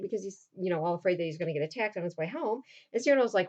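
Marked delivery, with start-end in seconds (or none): none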